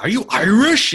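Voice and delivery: lightly voiced bartender. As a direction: ask like a pub bartender. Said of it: "the bartender was like".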